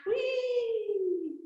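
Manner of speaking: a high, gleeful whee; excited whee. Real, not acted. Instructor cheering kids during rocking stretch, a high gleeful whee. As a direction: squeal it like a playground swing. Playful ride forward on bum.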